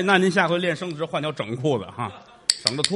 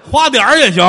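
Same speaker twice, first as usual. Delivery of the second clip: loud egging on; 8 semitones higher, 11 dB louder than usual. Acted. Egging on loudly.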